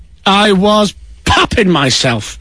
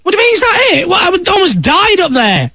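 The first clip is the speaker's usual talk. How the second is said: much higher, outraged squawk; can't believe that's all he said; indignant disbelief; 11 semitones higher than usual. Real, not acted. Outrage after near-death flight.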